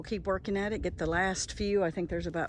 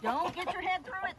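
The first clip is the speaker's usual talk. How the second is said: high, alarmed, pleading with chicken; urgent high warning; 10 semitones higher than usual. Real, not acted. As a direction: stop the chicken in a panic.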